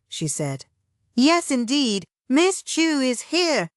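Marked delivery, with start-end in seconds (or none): 1.1-3.7 drawled, high. A narrator's reading. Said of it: "only knew one person who talked like that".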